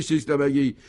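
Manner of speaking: usual speaking voice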